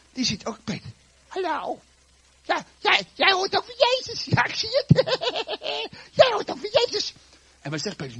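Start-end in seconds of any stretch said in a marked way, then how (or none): 1.3-7.1 very high, squeaky tot with a giggle; even tinier girl. Acted. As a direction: squeal it like a tiny, teasing child. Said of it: "En dat kleine meisje".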